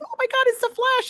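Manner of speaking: high-pitched mock fangirl squeal; imitated fan scream. Fan spotting the Flash off screen, imitated scream. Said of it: "someone screams off screen saying".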